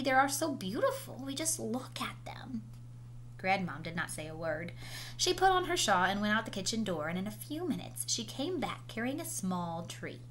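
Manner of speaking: ordinary delivery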